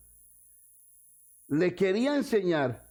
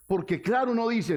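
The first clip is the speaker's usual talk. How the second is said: normal talking voice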